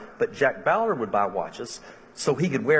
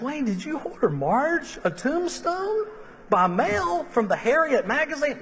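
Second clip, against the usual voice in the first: incredulous; 13 semitones higher than usual. Retold or imitated. Pastor asking Wayne about the mail-order tombstone, incredulous. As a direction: ask it as if you can't believe it.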